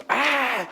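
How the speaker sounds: sudden high wail imitating the toddler; a quick mock cry of a hurt baby; imitated baby cry. Father imitating his fallen son's cry. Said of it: "en eso empezó".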